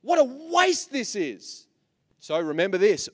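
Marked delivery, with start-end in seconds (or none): 0.0-1.7 higher, louder hint of a scolding crowd; hammed-up disapproval, pitched up; mock scolding. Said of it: "It scolded her".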